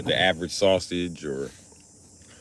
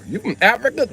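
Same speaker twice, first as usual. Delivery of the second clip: surprised woman; 3 dB louder than usual. Acted. Woman asking in the bit.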